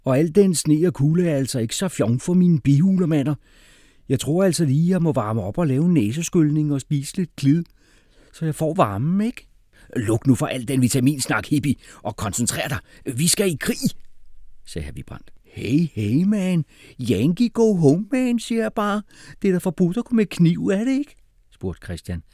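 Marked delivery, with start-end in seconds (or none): 0.0-9.5 high, loud whiny complaint; fussy complaining boy. Acted. 10.0-13.8 lightly voiced irritated commander; bossy war-minded man. 15.4-21.1 very high, loud protesting voice; alarmed hippie objector. Acted.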